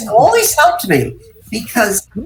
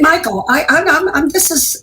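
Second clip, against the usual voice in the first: higher, tripping over the words; flustered protest; 9 semitones higher than usual. Retold or imitated. Young actress protests to director, flustered and high. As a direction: sputter your objection to him.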